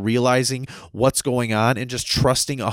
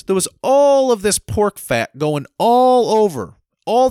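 slow loud emphasis; 7 semitones higher, 6 dB louder, slower than usual. Real, not acted. Ranting about stimulus pork.

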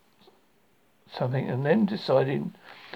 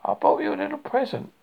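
high, louder, eager offer; excited; 13 semitones higher, 5 dB louder than usual. A narrator's reading. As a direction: burst out with excitement about a gift. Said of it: "said Pooh excitedly".

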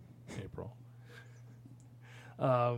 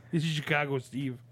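Chicago accent pushed louder; 2 semitones higher than usual. Shown as a demonstration. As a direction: lean harder into the Chicago accent. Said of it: "Can you do it again?".

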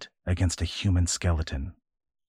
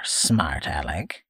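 "smart aleck" drawn out slowly, lower, SMART rising; slow taunt; 4 semitones lower, slower than usual. Acted. Old man taunting the boy, slow and low. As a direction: drag out "smart aleck" mockingly.

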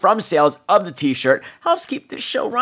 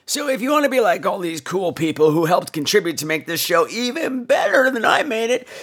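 normal talking voice

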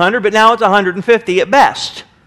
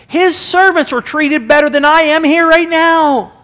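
son's realization; 8 semitones higher than usual. Retold or imitated. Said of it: "It's all like".

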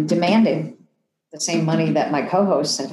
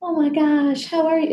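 much higher, delighted reunion cry; excited greeting; 11 semitones higher than usual. Retold or imitated. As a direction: squeal it like hearing from a long-lost friend. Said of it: "And we're like".

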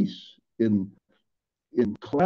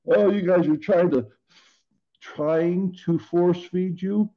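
higher-pitched imitation of an accusing listener; mock accusation; 7 semitones higher than usual. Preacher voices listeners who feel force fed, higher mock accusation. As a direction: mimic an objector, pitched up and drawn out toward the end.

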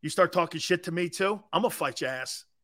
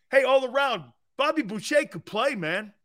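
high excited chant; mock cheer; 8 semitones higher than usual. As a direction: cheer it like a fan in the stands.

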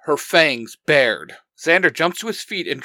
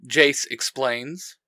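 ordinary delivery

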